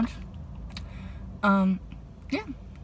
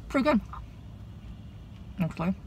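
talking around food; muffled closed-mouth speech; mouth full, chewing; 4 semitones higher, 9 dB quieter, faster than usual. Real, not acted. Seen 1: Bites sandwich at -2; closed-mouth chewing, full cheeks while speaking.

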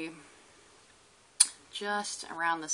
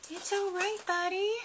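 pet talk; 12 semitones higher than usual. Real, not acted. Soothing the lizard.

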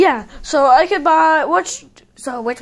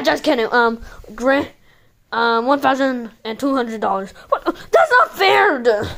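ordinary delivery

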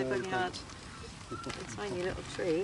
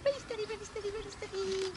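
soothing dog; 12 semitones higher, 6 dB quieter, much faster than usual. Real, not acted. Calming dog gently.

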